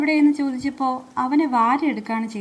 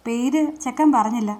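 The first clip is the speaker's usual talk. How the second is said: usual speaking voice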